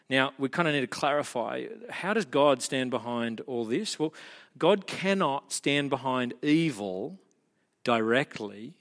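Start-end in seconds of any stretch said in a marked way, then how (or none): none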